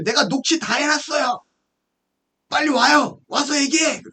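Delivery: high, pushy retold demands; angry demand. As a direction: act out a caller ordering him over. Acted angry caller demands meeting.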